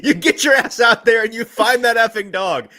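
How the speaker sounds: high, loud shouted movie quote; yelling. Retold or imitated. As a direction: bellow it like an unhinged teacher. Quoting a yelled movie line.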